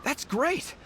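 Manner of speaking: high, delighted exclamation; excited. Acted. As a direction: burst out with relief. Goro relieved help arrived.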